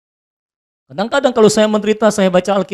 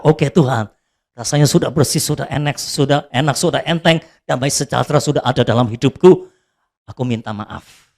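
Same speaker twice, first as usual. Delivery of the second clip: calm relieved prayer; 7 semitones lower than usual. Retold or imitated. Retold calm prayer after relief. (doa tenang dan lega)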